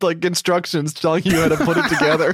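reading through laughter; laughing while reading. Retold or imitated. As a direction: voice lifted and giggly. Acting out friend reading instructions.

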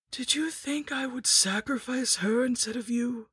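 high, slow whispered crone menace; slow, creepy crone taunt; whispering lich. A narrator's reading. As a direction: hiss scorn at the stupid boy.

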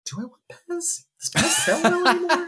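mock incredulous. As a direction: play it like the question is absurd. Vendor pitches Mario Pez set.

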